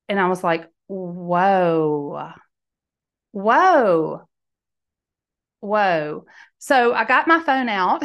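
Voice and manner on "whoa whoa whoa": drawn-out stunned whoas; amazed whoa. Retold or imitated. Woman hearing the saying again, stunned and amazed. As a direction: let each whoa hang in awe.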